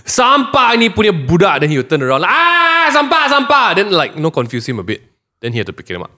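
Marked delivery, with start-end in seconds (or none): 0.0-1.6 much higher, loud exclaiming in Malay; loud outburst. Retold or imitated. Shaming a litterer loudly in public, shouting. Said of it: "I'll go". 2.4-3.7 much higher, loud exclaiming in Malay; loud outburst. Retold or imitated. Shaming a litterer loudly in public, shouting. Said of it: "I'll go".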